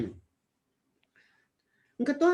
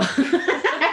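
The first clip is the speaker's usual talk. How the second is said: bursting into loud falling laughter; a helpless laugh that spills out and drops away; real laughter; 7 semitones higher, 6 dB louder than usual. Real, not acted. Mother watching her daughter cry on video, laughing helplessly.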